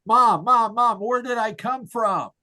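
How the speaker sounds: retold boy yelling for his mom; mock child's yell. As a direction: call out like an eager seven-year-old. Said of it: "yelling at his mom".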